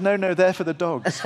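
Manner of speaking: bursting into laughter on the punchline; laughing through it. Retold or imitated. Telling the host the Queen's corgi joke, laughing.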